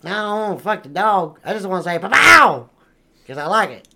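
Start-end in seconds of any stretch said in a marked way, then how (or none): 2.0-2.7 high, booming exclamation; shouted catchphrase. Acted. Explaining he just likes saying it, shouted catchphrase. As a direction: blast it out for the sheer fun of it. Demonstrates what he wants to say.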